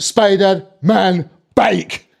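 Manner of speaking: very slow, word-by-word Scottish demand; Scottish accent. Acted. Scottish kid repeating his wish to Santa, deliberate Scottish accent. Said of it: "this one Scottish kid".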